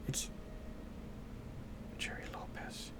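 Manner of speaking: a breathy whisper, half to himself, trying out the name; hushed whisper of a half-remembered name; whispered to self. Real, not acted. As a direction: whisper the name as if testing your memory.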